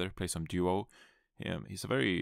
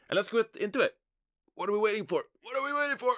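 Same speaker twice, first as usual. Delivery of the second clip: much higher, louder, pumped-up intro voice; hyped-up host; 4 dB louder, faster than usual. Acted. Host kicking off the podcast to listeners, pumped and high. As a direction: launch the show like a game-day announcer. Hyping up the episode start.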